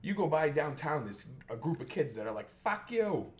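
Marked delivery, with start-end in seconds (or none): 2.5-3.3 put-on high snotty kid jeer; mock kid taunt. Kids on the corner taunting a passerby, mock sneer.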